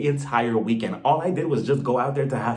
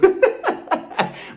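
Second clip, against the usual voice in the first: airy, higher laugh; breathy laugh. Real, not acted. Cracking up at her own college-daughter bit.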